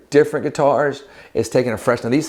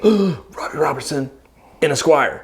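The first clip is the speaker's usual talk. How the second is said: slow, higher, amazed; retelling his first reaction, awed; excited realization; 4 semitones higher than usual. Retold or imitated. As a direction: say it like a discovery.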